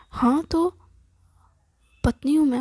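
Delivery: mock-indignant comeback to a husband; indignant wife. Wife defends right to scold.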